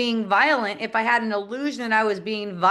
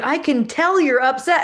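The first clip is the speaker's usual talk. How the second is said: teasing son; 5 semitones higher, 5 dB louder than usual. Retold or imitated. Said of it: "he's like".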